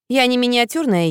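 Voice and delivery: plain reading voice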